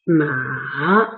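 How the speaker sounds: ma drawn out in a V shape; dipping third tone. Shown as a demonstration. Teacher repeating for learners, dip and rise. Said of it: "lower it and rise it".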